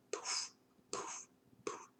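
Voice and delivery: breathy whispered puffs, soft and hushed; hushed puff sounds, like smoke escaping; whispered poof. Retold or imitated. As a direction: mimic each puff of smoke under your breath. Smoke puffing from her mouth.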